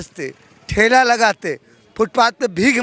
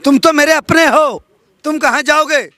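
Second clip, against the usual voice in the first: louder, higher mock-insistent quote; imitating party leaders; 6 semitones higher, 6 dB louder than usual. Party leaders claiming a worker as their own, loud imitation. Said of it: "BJP के नेताओं ने क्या कहा मुझसे".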